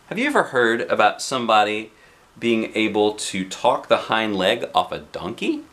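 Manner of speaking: ordinary delivery, nothing marked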